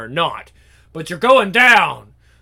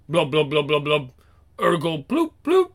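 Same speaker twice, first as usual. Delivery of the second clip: gurgling fish man croak; 4 semitones lower, 5 dB quieter than usual. Acted. Toilet creature gurgles.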